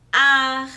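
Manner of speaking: each syllable held, ending in a strong throaty R. Shown as a demonstration. Teacher showing pupils the R after each vowel, strong R. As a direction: draw out the vowel, then a raspy H-like R. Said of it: "ele também tem um som forte".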